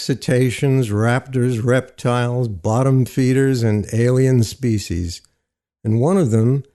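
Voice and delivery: usual speaking voice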